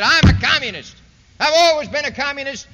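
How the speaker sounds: loud, proud shouted admission; defiant declaration. Retold or imitated. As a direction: boom it out like a dictator confessing proudly. Mao quoted admitting communism.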